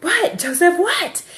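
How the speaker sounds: high call across a field, acted out; retold mother calling out to a distant child; calling back. Mom answering her son running across the field, calling out.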